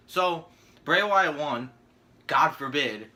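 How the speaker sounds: ordinary talk, nothing marked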